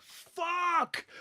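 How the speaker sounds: loud, high yelled curse; acted-out shout of frustration; frustrated outburst. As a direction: yell it like you just got outplayed. Acts out his frustrated in-game reaction.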